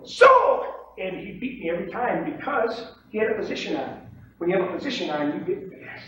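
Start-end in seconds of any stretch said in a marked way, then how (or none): 0.0-0.6 high, loud trading-floor yell; retold pit shout. Two traders racing to sell, retold pit shout. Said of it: "and we'll both go".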